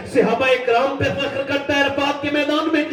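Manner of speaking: normal talking voice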